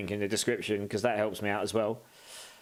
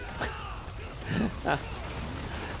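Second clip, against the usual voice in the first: breathy laugh; 7 semitones higher, 5 dB quieter than usual. Real, not acted. Laughs at own mistake.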